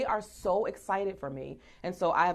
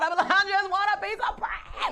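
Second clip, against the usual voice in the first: mock yell; 11 semitones higher than usual. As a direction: yell out the bread craving. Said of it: "I just yell out".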